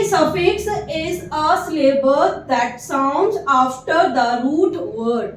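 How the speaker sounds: very slow, word by word; slow dictation. Shown as a demonstration. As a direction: read it out for students to copy. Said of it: "dictating a definition slowly".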